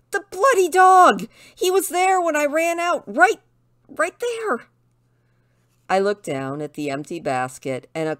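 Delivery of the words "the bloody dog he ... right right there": high-pitched, loud cry of alarm; panicked outburst. Acted. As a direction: burst out in a frantic high voice. Tristan panics over missing dog.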